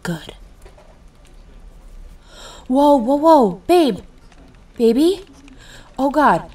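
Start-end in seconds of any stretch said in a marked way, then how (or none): 2.7-6.5 loud, high, alarmed cries as she falls; panicked calling. Acted. Boyfriend watching his girlfriend faint, panicked loud calls. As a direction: shout her name in sudden panic.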